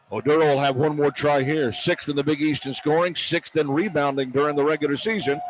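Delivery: normal talking voice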